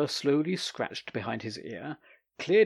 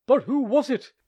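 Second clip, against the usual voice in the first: high, loud bewildered cry; frantic cry; 13 semitones higher, 12 dB louder, slower than usual. Acted. Akinyeyev asks himself who gossiped, loud frantic cry. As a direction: call out in confused despair. Perplexed man cries out.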